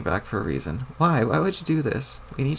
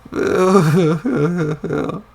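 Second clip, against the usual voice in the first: drawn-out, much louder mock wail; over-the-top sobbing; loud wailing boo-hoo; 3 semitones higher, 7 dB louder, much slower than usual. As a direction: wail it slowly like a ghost in grief. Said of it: "Jade, please don't".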